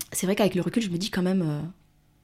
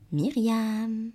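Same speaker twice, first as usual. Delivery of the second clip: higher, eerie name-call; haunting little voice; 5 semitones higher than usual. Retold or imitated. Little voice haunting her before the exam, eerie call. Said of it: "cette petite voix, elle me hantait".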